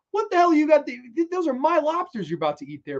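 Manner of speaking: high-pitched indignant challenge, loud at first; mock confrontation. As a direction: act out storming up to a rival's table. Acted confrontation over lobsters.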